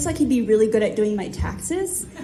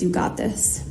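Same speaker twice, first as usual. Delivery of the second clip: low, near-whispered encouragement; hushed, low imitated pep talk; quiet reassurance; 4 semitones lower, 5 dB quieter than usual. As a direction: reassure softly under your breath. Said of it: "Caleb looks over to me and says".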